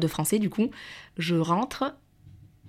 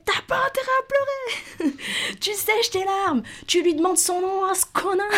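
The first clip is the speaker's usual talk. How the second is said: father telling her off. Retold or imitated. Father scolding his crying daughter on the phone, high mock scolding. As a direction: mimic an angry parent in a high, stretched squeal. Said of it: "il commence à m'engueuler".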